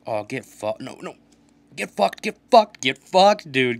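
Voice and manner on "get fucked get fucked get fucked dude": triumphant, much louder jeering; bellowed taunt; taunting shout. Real, not acted. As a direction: yell it louder each time at the opponent. Taunting opponent after goal.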